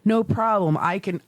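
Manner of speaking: normal talking voice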